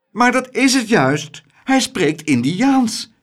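hoog, nadrukkelijk protest; tegenwerpend. A narrator's reading. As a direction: werp het luid tegen, gerekt slot. Mevrouw werpt tegen in ruzie. (nadrukkelijk protesteren)

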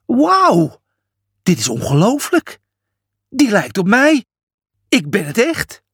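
high, excited wow of disbelief; amazed. A narrator's reading. Julius seeing his own giant statue, amazed.

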